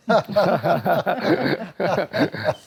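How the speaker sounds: a real laugh that falls away; hearty laugh. Real, not acted. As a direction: let out a warm genuine laugh. Laughing after nostalgic aside.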